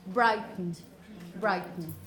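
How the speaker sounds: "Brighton" with a strong first syllable and weak, dropping end; low, falling "Brighton", ending reduced to almost nothing; stress on first syllable. Shown as a demonstration. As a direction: lean on the start of "Brighton", let the rest go tired. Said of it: "the stressed syllable, the first one".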